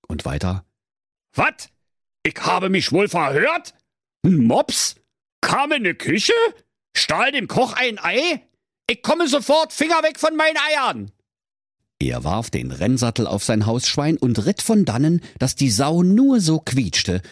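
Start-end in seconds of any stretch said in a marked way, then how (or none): 1.3-11.1 very high squeaky Berlin cook, getting worked up; outraged cook. Acted. Cook on the phone hearing about the egg thief, high squeaky outrage. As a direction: squeal the news like an offended cartoon chef.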